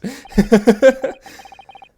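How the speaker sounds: real, loud cracking-up laugh; loud burst of laughter. Real, not acted. Player laughs at the accent.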